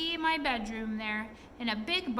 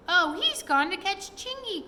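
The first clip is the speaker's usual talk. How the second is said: high, loud alarmed cry; Molly sees the danger and cries out, higher and louder; 7 semitones higher, 6 dB louder than usual. A narrator's reading. Molly frightened for Chingy, high loud alarmed cry. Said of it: "cried Molly".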